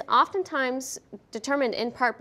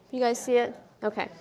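ordinary delivery

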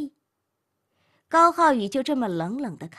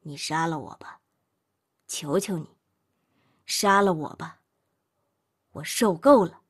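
low and broken, swelling louder at the end; utterly desolate; 6 semitones lower, 3 dB louder than usual. A narrator's reading. Man holding the knife to his own throat, desolate. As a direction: beg her to end it, out of despair. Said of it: "无限凄凉地说".

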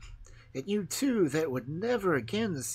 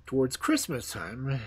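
ordinary delivery, nothing marked

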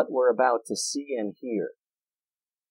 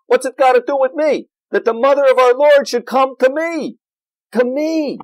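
blunt, loud New Jersey voice, higher and louder; brash New Jersey quip, fast; New Jersey style; 9 semitones higher, 6 dB louder than usual. Retold or imitated. Pastor voicing Elizabeth as a brash New Jersey local. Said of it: "more like how they talk where I'm moving to".

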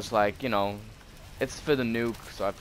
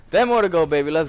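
louder, higher hyped call; excited shout; 5 semitones higher, 8 dB louder, faster than usual. Real, not acted. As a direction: shout it like a streak is on. Hyped repeat of the count.

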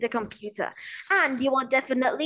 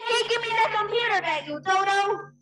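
high indignant yell; annoyed sibling; 7 semitones higher than usual. Acted. Big sister demands her computer back from brother, indignant yell. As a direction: snap at the little brother.

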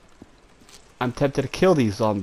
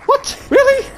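very high, loud squeal of shock; shrieked disbelief; 8 dB louder than usual. Real, not acted. Player shocked at being found, shrieked disbelief.

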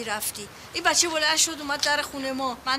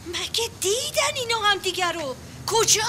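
alarmed surprise; 6 semitones higher than usual. Acted. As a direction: jolt up in shock. Aunt shocked by the news. (با تعجب و نگرانی ناگهانی)

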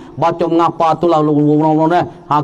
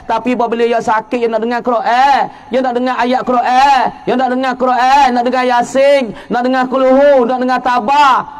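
rising preaching; 4 semitones higher than usual. Acted. Preacher urging parents to teach the Quran, building. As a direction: build the list to a climax.

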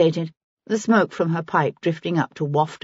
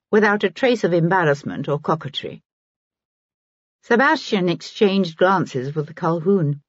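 plain reading voice

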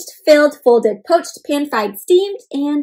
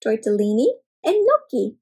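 ordinary delivery, nothing marked

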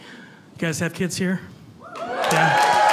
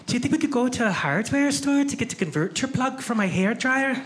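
higher-pitched put-on Scottish woman's voice; Scottish accent; 5 semitones higher than usual. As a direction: play the visitor with a lilting Scottish accent. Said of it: "just flown in from Scotland".

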